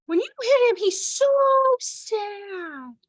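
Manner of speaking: put-on high sing-song baby-talk, overdone; motherese. Shown as a demonstration. Teacher demonstrating the voice that drives her crazy, exaggerated motherese. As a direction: lay on the sugary motherese so hard, drawn out. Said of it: "Here's the voice that makes me crazy".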